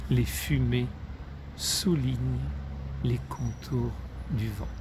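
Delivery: subdued recital, broken by pauses, fading on "les contours du vent"; poem's fading close. A narrator's reading. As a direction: let "les contours du vent" die away softly. Repeats closing verse to end poem.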